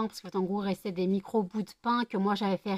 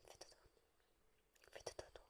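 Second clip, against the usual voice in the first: whispering over a crib. Real, not acted. Lulling the dogs to sleep.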